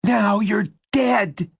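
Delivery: high, slow rasping threat; furious rasp. A narrator's reading. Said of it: "she rasped".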